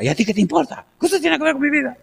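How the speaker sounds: high-pitched, snotty comeback; exaggerated high-pitched defiance; mock insolent retort. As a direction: imitate a daughter snapping back in a shrieky voice. Imitates daughter's usual rude retort.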